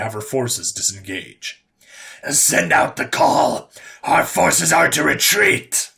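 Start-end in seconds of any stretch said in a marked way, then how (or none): 2.3-5.9 resounding raspy command, slow and raised; deep, raspy overlord. A narrator's reading. Overlord ordering her forces to retreat, deep raspy resounding call. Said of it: "her deep and raspy voice resounded".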